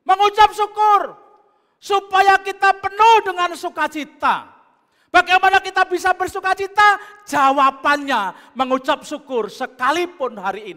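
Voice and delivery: loud, high preaching climax; preaching at full force. Acted. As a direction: proclaim it to the whole congregation. (khotbah berapi-api dengan suara lantang)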